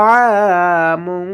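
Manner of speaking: "আমুন" drawn out with a humming nasal ending; ghunnah. Shown as a demonstration. Teacher showing ghunnah, long nasal hum ending "আমুন". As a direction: hold the n of "আমুন" through the nose. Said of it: "মুন গুন্না হবে".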